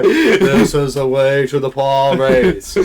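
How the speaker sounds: slow, loud booming prophet voice; booming arrogant alien overlord. Pretend. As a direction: boom it out like a godlike alien. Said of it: "Big booming voice".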